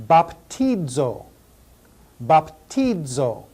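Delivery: Baptizo slowly, bap-TEE-zo; drawn-out Baptizo syllable by syllable; Baptizo, slow teacher demo; slow Greek pronunciation. Shown as a demonstration. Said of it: "the verb that means I baptize".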